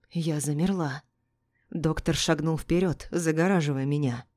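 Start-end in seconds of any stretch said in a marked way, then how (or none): none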